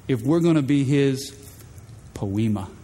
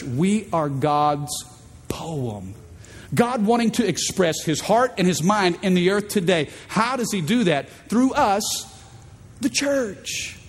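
ordinary delivery

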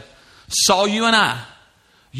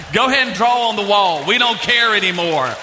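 exasperated parent; 5 semitones higher than usual. Acted. Voicing lax parents of babies.

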